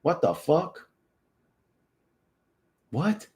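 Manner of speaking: normal talking voice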